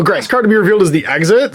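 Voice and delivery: ordinary delivery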